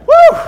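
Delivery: very high, loud whooping woo; exhausted woo. Acted. Reaching Sunday worn out, loud high whooping woo. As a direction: let out a big worn-out woo.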